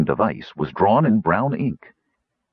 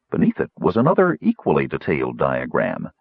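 ordinary delivery

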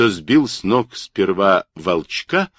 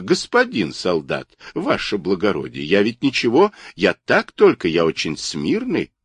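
ordinary delivery

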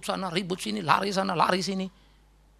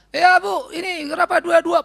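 mock innocent; 9 semitones higher, 4 dB louder, slower than usual. Cheekily asking shop owner price.

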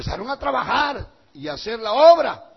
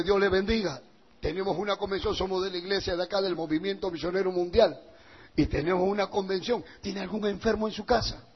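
polite doorstep greeting; 8 dB quieter, faster than usual. Shown as a demonstration. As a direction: introduce yourself gently to a neighbour, voice down. Models a doorstep invitation.

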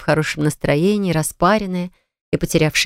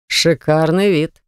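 husky, slow male voice; hoarse; slower than usual. Acted. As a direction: say it hoarsely and slowly. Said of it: "как-то очень хрипло произнёс Суворов".

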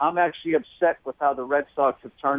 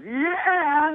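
high shrieked mock crowd call; crowd catchphrase yell; 13 semitones higher than usual. Fan shouting a Chappelle catchphrase, high mock yell. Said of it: "somebody else ... goes".

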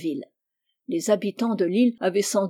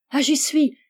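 higher-pitched burst of understanding; delighted realisation; 6 semitones higher, 4 dB louder than usual. A narrator's reading. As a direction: suddenly get it, thrilled. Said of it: "dit Rose en battant des mains". (déclic joyeux, voix haute)

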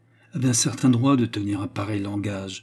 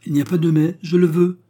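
louder and higher, imperious; stamping command; 7 semitones higher, 7 dB louder than usual. A narrator's reading. Turquoise cutting off Fernand's objection, loud commanding. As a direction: order it, no objections allowed. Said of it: "en frappant le parquet de son joli pied".

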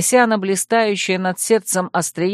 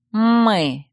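meaningful correction; 3 semitones higher than usual. Acted. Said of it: "многозначительно поправил его Колин".

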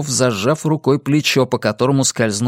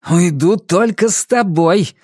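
slow, higher-pitched defiance; spiteful, stubborn reply; angry retort; 5 semitones higher, 3 dB louder, slower than usual. A narrator's reading. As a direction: refuse angrily, stretching the words. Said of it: "злобно ответил юноша".

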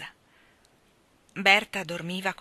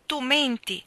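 higher and louder accusation from the fairy; fairy accusing; 5 semitones higher, 5 dB louder, slower than usual. Acted. Fairy Zilla accusing Ermanno, raised and sharp. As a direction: fling the charge at him. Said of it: "rispose Zilla".

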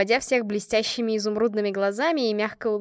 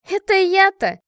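higher, louder indignant exclamation; 10 semitones higher, 8 dB louder than usual. A narrator's reading. Лика protests being called the kindest, indignant. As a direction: burst out, affronted at being called kind. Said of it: "Лика возмущённо взмахнула".

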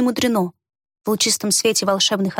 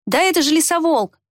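excited exclamation; 7 semitones higher than usual. A narrator's reading. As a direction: blurt it out in wonder. Boy recognizes the beast. (радостное восклицание)

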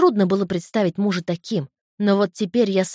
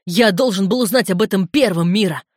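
loud, hurt-brother scolding; angry reproach; 6 dB louder than usual. A narrator's reading. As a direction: reproach a sister forcefully. Брат упрекает сестру. (громкий сердитый упрёк)

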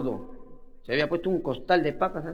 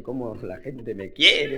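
slow, lower, lightly smug thief voice; 6 semitones lower, much slower than usual. Acted. Thief thinks the crowd loves him, slow smug murmur. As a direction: savour it like a vain crook.

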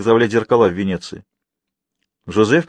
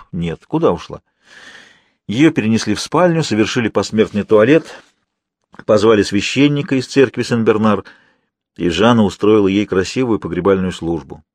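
ordinary delivery

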